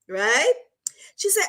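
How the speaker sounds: usual speaking voice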